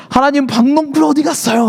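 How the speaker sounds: high-pitched indignant demand; mock angry complaint. Jonah asking God where the vine went, indignant. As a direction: whine upward in retold outrage. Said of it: "또 분노를 하기 시작해요".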